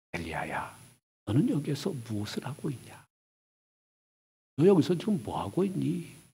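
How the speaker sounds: 부드럽게 낮춘 하나님의 음성; 가만히 속삭이듯 묻는 부드러운 음성; 조용한 목소리. Acted. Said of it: "조용한 소리가 들리니까". (조용히 묻는 세미한 음성)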